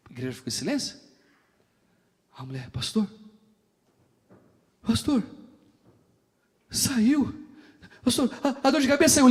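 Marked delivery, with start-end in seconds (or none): 2.7-9.3 rising, louder, breathless with joy; excited woman. Retold or imitated. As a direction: announce the healing to the pastor, thrilled. Mulher anuncia cura ao pastor. (mulher eufórica, cada vez mais alto)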